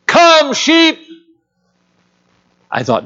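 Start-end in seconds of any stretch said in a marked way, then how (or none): 0.0-1.0 high, loud, drawn-out holler to a flock; big pastoral farm call, pitched way up; yelled sheep call. Retold or imitated. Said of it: "he yelled up that hillside".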